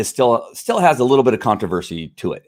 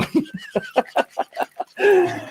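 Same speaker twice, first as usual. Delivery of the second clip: sudden high giggle; real amused high-pitched laugh; 3 dB quieter than usual. Real, not acted. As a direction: burst into a squeaky laugh. Laughing at looking odd.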